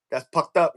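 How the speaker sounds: "pucked" with P in place of F; Filipino F as P. Shown as a demonstration. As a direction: hit the P in "pucked". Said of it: "all the F's are P's".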